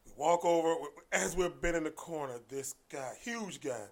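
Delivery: usual speaking voice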